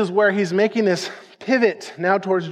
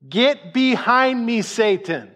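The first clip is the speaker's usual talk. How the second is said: slow, higher-pitched stern command; grave rebuke; 3 semitones higher, slower than usual. Acted. Preacher voicing Jesus turning on Peter, slow stern rebuke. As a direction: draw the command out firmly, then drop on the name. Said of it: "This is how big of a deal".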